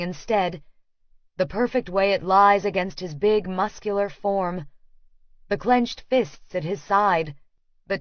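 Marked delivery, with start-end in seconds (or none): none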